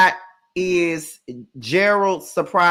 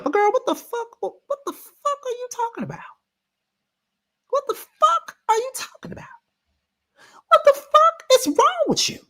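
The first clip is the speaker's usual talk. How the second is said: much higher, squealing half-laughing outrage; high, wailing mock horror at a castmate; shrieking disbelief; 4 dB louder than usual. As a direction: screech at her like you can't believe it. Ranting at Kay in disbelief.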